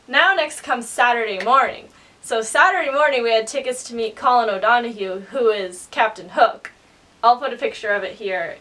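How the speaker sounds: normal talking voice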